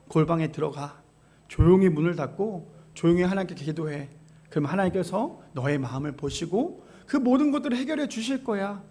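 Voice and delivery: soft, hushed and tender, as God inviting prayer; a preacher voicing God to the congregation, quietly reassuring; gentle voice of God. 하나님의 부드럽고 조용한 음성. God speaking through the passage.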